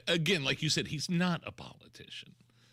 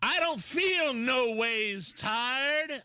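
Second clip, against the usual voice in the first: much higher, louder, slow Southern drawl; slow hammy church-preacher Southern accent; fake Southern preacher accent; 12 semitones higher, 4 dB louder, much slower than usual. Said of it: "you go back into the two thousands when she was".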